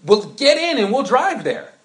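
high, loud mock insistence; exasperated call. Pastor urging the listener into the car, high and loud. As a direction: urge them into the car, fed up. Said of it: "I'm like".

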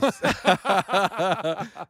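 loud, high belly laugh; burst of laughter. Real, not acted. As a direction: crack up out loud. Cracks up at own joke.

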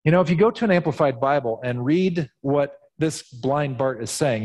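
usual speaking voice

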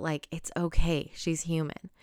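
usual speaking voice

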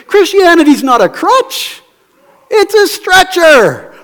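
high, loud declaration, drawn out at the end. Retold or imitated. Singer answering critics of Christianity, emphatic retort. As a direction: belt out the punchline. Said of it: "And he would say".

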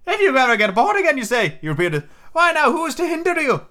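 high, sly castaway voice; high excited old islander; Ben Gunn voice. A narrator's reading. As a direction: perk up like a cunning hermit.

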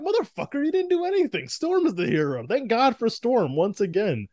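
high, exasperated mock rant; high-pitched rant. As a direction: squeak it out in disbelief. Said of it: "I'm like".

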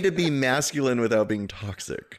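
loud declaration. Real, not acted. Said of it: "Is it okay to not yell into the mic".

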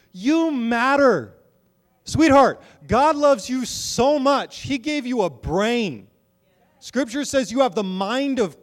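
high, loud, slow preaching that stretches each phrase; urging the congregation to trust their own minds, impassioned preaching; preaching at full force. Acted. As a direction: lift the room with it, drawn out.

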